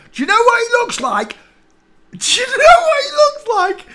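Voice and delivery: very high, loud mocking question; shrill playful tease; squeaky high teasing. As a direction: squeak it out in delight.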